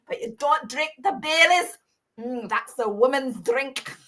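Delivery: hammed-up Scottish old-timer; Scottish accent. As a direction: put on a Scottish accent to scorn the drink. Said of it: "attempting to do a, a Scottish accent".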